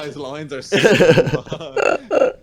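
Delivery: loud, slow, laughing through words. Real, not acted. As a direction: crack up while saying it. Laughing at bad dialogue.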